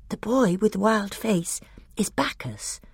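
high, slightly hushed whisper. A narrator's reading. Lucy tells Susan who the boy is, whispering. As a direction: confide it in a high, light whisper. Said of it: "whispered to Susan".

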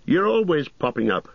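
high, put-out innkeeper voice; vexed landlord, pitched well up; exasperated cry. A narrator's reading. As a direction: exclaim in annoyance at an unwelcome visitor. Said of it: "he cried".

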